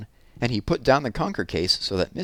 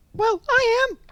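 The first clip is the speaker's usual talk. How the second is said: tiny squeaky bear voice; little muffled voice; 5 dB louder than usual. Acted. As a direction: squeak it from under the leaves. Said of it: "said a little muffled voice".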